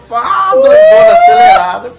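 excited shout. Retold or imitated. Describing wired person.

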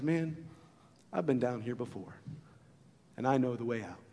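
low, hushed friend's voice; steady him with a soft, low, unhurried answer; acted quiet confidence, with pauses; calm reassurance. Friend calms the livid man.

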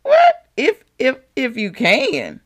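a mock-shocked Jesus repeating "if you can"; incredulous Jesus. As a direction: play Jesus baffled by the doubt, "What? What?". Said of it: "Jesus probably looked like".

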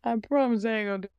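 fast, pleading mock prayer; lightly voiced insincere penitent; mocking her old self. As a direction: rush a fake promise to God. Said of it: "I used to be like".

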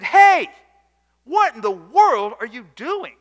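loud, high call to confront a cheating groom; confronting shout. Retold or imitated. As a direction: yell at him to stop him. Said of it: "we confronted that guy and we said".

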